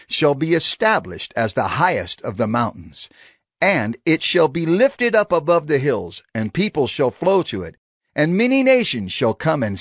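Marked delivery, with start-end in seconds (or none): none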